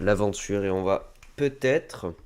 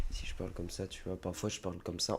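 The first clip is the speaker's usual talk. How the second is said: speaking too low; 2 semitones lower, 11 dB quieter, faster than usual. Shown as a demonstration. Streamer showing viewers he might not be heard, speaking too low. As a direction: demonstrate a low, barely audible speaking voice. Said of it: "on va pas m'entendre".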